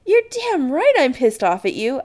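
high, loud indignant outburst; exclaiming. Acted. Adam to Chris who kept a secret, indignant exclamation. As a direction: fire it back loudly, pitch up. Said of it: "Adam exclaims".

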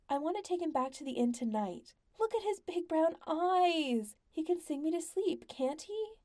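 high gushing lady, squealing with delight; spoiled wife gushing over music, high and delighted; Lady Wentz, delighted. A narrator's reading. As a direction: gush like a spoiled rich wife.

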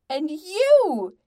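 loud, high accusing shout; enraged. A narrator's reading. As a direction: round on him in fury. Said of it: "in an uncharacteristic rage".

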